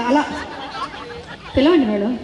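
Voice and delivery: ordinary delivery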